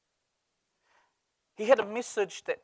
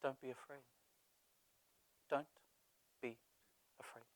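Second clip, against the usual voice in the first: gentle reassurance; 7 semitones lower, 18 dB quieter than usual. Shown as a demonstration. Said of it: "words that many of us need to hear".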